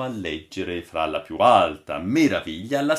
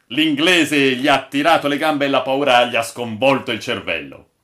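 loud, raised, scoffing voices; incredulous crew outcry; 5 semitones higher, 8 dB louder than usual. A narrator's reading. Sailors argue over the old man's claim, loud and incredulous. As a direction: protest as sailors who don't believe it.

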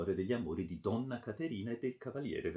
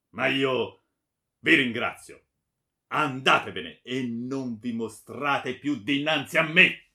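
loud, forceful send-off; angry dismissal; 3 semitones higher, 10 dB louder than usual. A narrator's reading. Baron banishing the friar from his sight, angry. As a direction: banish him at full voice.

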